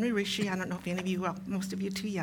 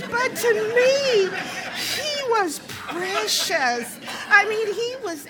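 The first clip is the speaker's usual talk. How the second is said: laughing through tears; 12 semitones higher, 9 dB louder than usual. Real, not acted. Laughs through tender memory.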